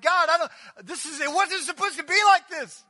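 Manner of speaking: high, loud anguished cry to God; despairing disciple crying out; confused follower. Acted. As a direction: plead with God, bewildered and hurt. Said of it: "They're like".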